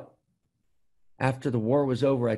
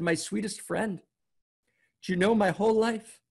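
mildly imploring, pitched higher; heartfelt plea; 6 semitones higher than usual. Retold or imitated. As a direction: retell an earnest plea, high and reverent. Teller voices heartfelt plea to hunchback.

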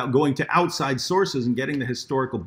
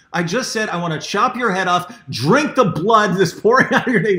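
high, louder telling of the punchline through a laugh; 6 semitones higher, 5 dB louder than usual. Retold or imitated. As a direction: deliver the shocking reveal while cracking up. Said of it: "And he goes".

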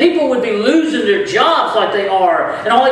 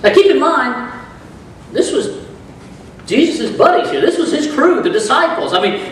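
ordinary talk, nothing marked

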